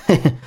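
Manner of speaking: brief chuckle; sly chuckle. Acted. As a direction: give a short heh-heh. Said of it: "转头嘿嘿一笑道". (嘿嘿一笑)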